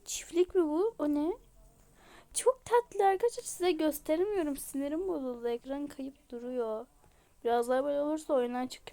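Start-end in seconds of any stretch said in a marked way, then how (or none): none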